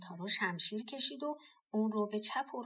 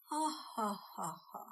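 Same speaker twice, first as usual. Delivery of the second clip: drawn-out, breathy ha ha ha ha; slow mocking demon laugh; 4 dB quieter, slower than usual. A narrator's reading. Green demons laughing off the threat, slow mocking laugh. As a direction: slowly laugh the demon's scornful laugh. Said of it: "قاه قاه خندشون".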